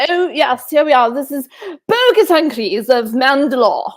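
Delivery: loud, lofty mock-British snob; pompous Monty Python English voice; snooty Monty Python accent. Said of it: "Monty Python snooty accent".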